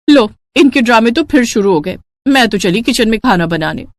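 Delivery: ordinary delivery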